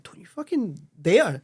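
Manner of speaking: retold indignant warning, high and drawn-out; annoyed outburst. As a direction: act out an offended "how dare you". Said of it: "I got really annoyed".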